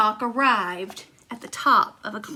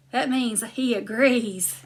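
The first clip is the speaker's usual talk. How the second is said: usual speaking voice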